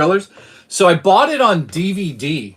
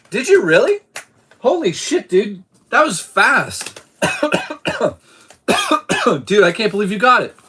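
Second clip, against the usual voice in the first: much higher, excited "holy shit, dude"; excited disbelief; 6 semitones higher than usual. Real, not acted. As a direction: burst out amazed at a viewer's win. Viewer won the code.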